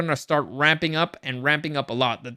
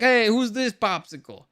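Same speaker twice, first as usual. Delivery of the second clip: lightly imitated Jersey-Italian accent, raised pitch; a hint of a higher-pitched Jersey-Italian accent; Tony Danza; 6 semitones higher than usual. Fan showing how Pietro's accent sounds, mock Jersey-Italian accent. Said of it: "he kinda sounds like Tony Danza".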